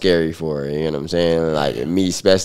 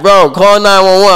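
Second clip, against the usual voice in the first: urgent plea; 14 semitones higher, 11 dB louder than usual. Retold or imitated. Cousin pleads to call 911.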